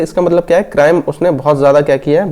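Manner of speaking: ordinary talk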